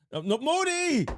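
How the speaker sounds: high held cry of Modi; calling out. Real, not acted. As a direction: wail his name up high.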